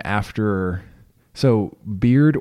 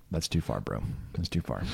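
quiet scolding; 4 semitones lower, 11 dB quieter than usual. Retold or imitated. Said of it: "Samantha's like".

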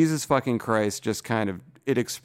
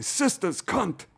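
Uncle Junior; 10 semitones higher, slower than usual. Retold or imitated. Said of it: "he'd say".

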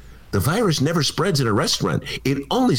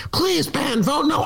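a hint of a pleading Phyllis, higher; lightly voiced caller begging; 12 semitones higher, slower than usual. Phyllis phones the host, lightly voiced pleading. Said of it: "Phyllis calling me again".

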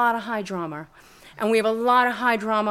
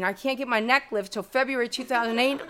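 ordinary talk, nothing marked

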